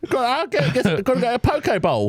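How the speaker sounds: hammed-up English lad, pitched high; English accent. Joking as an undercover Englishman to co-hosts, English accent. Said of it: "had to do an English accent".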